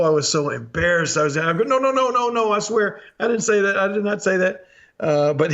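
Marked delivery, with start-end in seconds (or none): 1.6-4.7 much higher, flustered protesting; panicked denial. Retold or imitated. Embarrassed kid denying the insult to the cook, higher and frantic. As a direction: squeak out a frantic denial, then settle.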